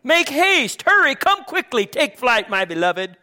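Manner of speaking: high, loud, impassioned call, easing at the end; urgent urging. Acted. Bride calling her beloved to come, urgent urging. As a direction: cry out to a lover to hurry. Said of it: "urging him in love".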